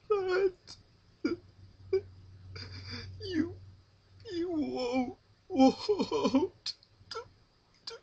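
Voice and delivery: high, loud, breaking sobbed begging; sobbing plea. Acted. Rarity begs Pinkie to spare her sister, sobbing. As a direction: beg through tears, voice cracking.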